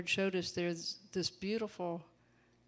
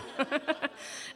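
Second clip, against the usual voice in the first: loud laugh; 8 semitones higher, 4 dB louder than usual. Real, not acted. Laughs after her own joke.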